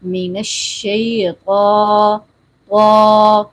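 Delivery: long drawn "الشیطا" with a full, heavy ط, no w. Shown as a demonstration. Teacher models correct recitation, heavy "طا" held long. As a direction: slowly recite "طا" thick and held, lips unrounded. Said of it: "طا کو ہم نے کرنا ہے موٹا".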